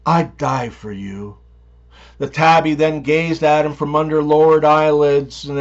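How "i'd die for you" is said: purred. A narrator's reading. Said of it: "and purred".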